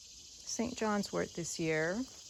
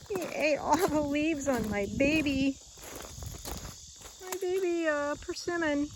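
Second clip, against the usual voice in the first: dismayed; 7 semitones higher than usual. Real, not acted. Upset about deer eating persimmon.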